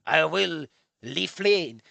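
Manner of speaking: slow mock-Japanese with L and R muddled; fake Japanese accent. Old man fools nobody, fake Japanese accent. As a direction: botch the Japanese accent slowly. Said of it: "The Japanese mixture L R does not".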